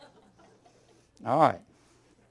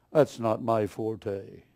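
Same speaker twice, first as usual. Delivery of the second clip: mocking the preacher; 6 semitones lower, 4 dB quieter, much slower than usual.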